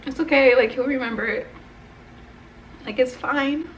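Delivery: high, strained reassurance; upset reassurance. Retold or imitated. Grandchild comforting grandmother who forgot them, upset. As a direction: reassure someone while close to tears, voice climbing.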